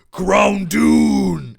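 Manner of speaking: Groundoon boomed out loud and drawn out; all caps. Shown as a demonstration. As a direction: shout the name like it's written in capitals. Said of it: "It's in all caps".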